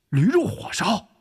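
loud, high exclamation; crying out. Acted. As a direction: blurt it out in a shout. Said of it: "喊了出来". (脱口高喊)

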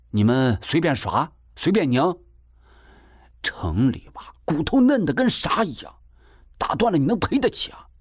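scolding. A narrator's reading. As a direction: tell him off, pitch rising to an indignant question. 娘训斥儿子动手. (音调越来越高地训斥)

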